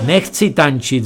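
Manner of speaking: usual speaking voice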